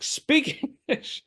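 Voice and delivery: high, slow self-mocking demand "Speak English"; mock angry yell. Stranded American snaps at Chinese driver, mock yell. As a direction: ham up the rude American. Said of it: "this is how stupid I am".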